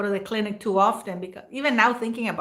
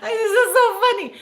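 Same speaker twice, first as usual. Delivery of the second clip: high laughing squeal; 12 semitones higher, 6 dB louder than usual. Real, not acted. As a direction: laugh through the words. Laughing at own story.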